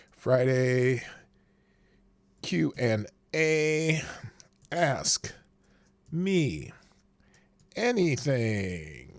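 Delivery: slow drawn-out words while typing; typing dictation. Shown as a demonstration. Host typing the Q and A title for viewers, slow dictation. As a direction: spell it out slowly as you type. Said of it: "reads out what he types".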